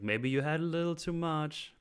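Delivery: a light mock of a club guy; mock teasing. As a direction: tease like a tipsy friend. Voicing a man mocking someone.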